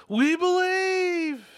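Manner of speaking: very high, drawn-out loud cry; mimicked crowd roar. Preacher likening us to the crowd, mimicked roar. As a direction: belt it out long, like an eager crowd.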